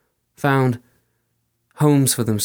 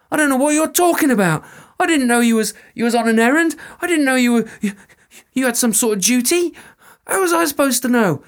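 much higher, louder, fast panicked pleading; panicked soldier; 13 semitones higher, 5 dB louder, faster than usual. Acted. Soldier pleads with the fairy woman, frantic and high. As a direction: babble out excuses in fear.

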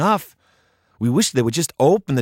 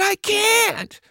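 put-on high fearful girl. Emily refusing, high voice.

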